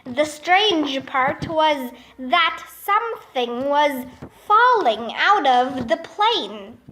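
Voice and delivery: ordinary delivery, nothing marked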